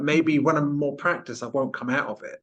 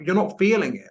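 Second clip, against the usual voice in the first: mock advert voice; 7 semitones higher, 4 dB louder than usual. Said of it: "It's like".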